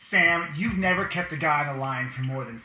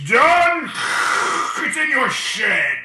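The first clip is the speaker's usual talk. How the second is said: booming genie voice; 8 semitones higher, 10 dB louder, much slower than usual. Acted. Genie grants wish.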